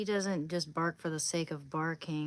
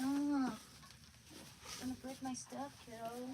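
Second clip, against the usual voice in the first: slow, sing-song mild scold; slow, gentle reproach to the dog; drawn-out scolding; 6 semitones higher, 6 dB quieter, slower than usual. Real, not acted. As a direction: stretch it out like scolding a puppy.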